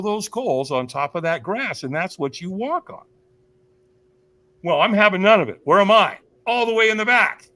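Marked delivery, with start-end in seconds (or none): 4.6-7.5 higher, loud acted-out refusal; defiant refusal. Speaker tells audience about dodging the firewalk, defiant. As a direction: act out digging in your heels, loudly. Acts out their own refusal.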